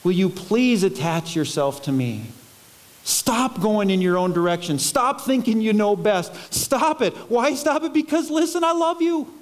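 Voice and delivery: escalating, loving insistence; pleading. Acted. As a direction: start restrained, climb insistently to the end. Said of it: "Can't you just hear Jesus pleading".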